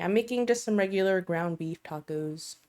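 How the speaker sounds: ordinary talk, nothing marked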